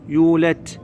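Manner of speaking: يولد wrongly ending in a hard "t"; final dal as T. Shown as a demonstration. Teacher shows what not to say, dal turned T. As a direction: harden the د of يولد to ت. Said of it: "لا تقول ت".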